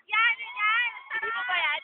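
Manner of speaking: normal talking voice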